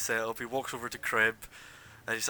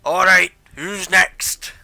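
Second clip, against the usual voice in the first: loud slow barker call; 8 semitones higher, 17 dB louder, slower than usual. Acted. Associate calling the next fighter to the pit, loud. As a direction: bellow it across the fight pit. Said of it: "And then he calls out".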